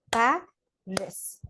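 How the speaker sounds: Paris said slowly, split into pa and ris; clapped syllables. Shown as a demonstration. Teacher to class, demonstrating syllables: slow, clapped Paris. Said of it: "I'm gonna clap twice for the word Paris".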